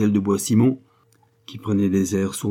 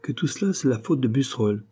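ordinary delivery, nothing marked

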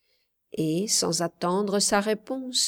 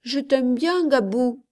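put-on higher child voice; affectionate little girl; 5 semitones higher, 4 dB louder than usual. A narrator's reading. Child telling Gabou of her affection, childlike. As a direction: reassure him in a raised, childlike voice.

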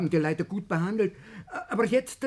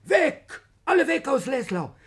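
alarmed outburst; 5 semitones higher, 5 dB louder than usual. Acted. Servant bursts in with news.

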